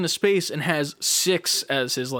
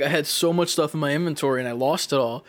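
ordinary talk

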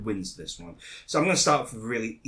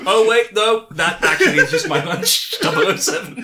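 sheepish Q; 5 semitones higher, 5 dB louder than usual. Acted. Q realising mistake.

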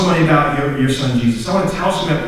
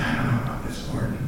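hushed prayer; 10 dB quieter, faster than usual. Retold or imitated. Retold hesitant prayer to God.

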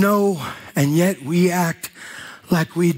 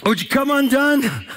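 preaching at full force; 8 semitones higher than usual. Acted. Preacher to the congregation, preaching at full force. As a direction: preach it out big and high to the crowd.

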